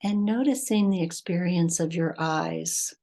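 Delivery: measured meditation voice with long pauses; guided meditation. Acted. Teacher leading listeners to breath and heart, guided meditation. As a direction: guide them unhurriedly, phrase by phrase, leaving space between.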